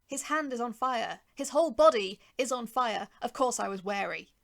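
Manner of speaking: insist, voice swelling loud; exasperated loud emphasis; emphatic outburst. A narrator's reading. Narrator's emphatic justification.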